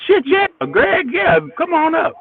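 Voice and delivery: high, bright, eager invite; eager welcome. Retold or imitated. To [name] at the bar, a high, eager come on up. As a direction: pitch it up like an excited yes.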